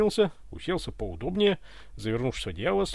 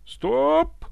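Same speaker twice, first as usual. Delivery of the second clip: high, loud startled shout; frightened yell; 13 semitones higher, 7 dB louder than usual. A narrator's reading. Startled Ivan halts the servants at his bed, frightened yell. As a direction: yell out in alarm. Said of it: "заорал испуганный Иван".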